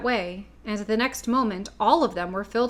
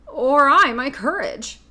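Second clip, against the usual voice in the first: slow, loud lion lament; lion asking mournfully, drawn out; Cowardly Lion voice; 4 semitones higher, 7 dB louder than usual. A narrator's reading. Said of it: "asked the lion".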